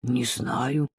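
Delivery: low, soft, stunned reply; taken aback. A narrator's reading. Father-in-law asked about renting the metro, taken aback. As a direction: answer quietly, thrown by the question. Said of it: "опешил тесть".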